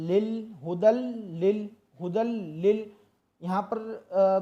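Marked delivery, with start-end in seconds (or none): 0.1-2.9 हुदल लिल with लाम pronounced twice; doubled lam. Shown as a demonstration. Teacher drilling the doubled lam. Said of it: "लाम मुशद्दद है तो इसको दो मरतबा अदा करना है".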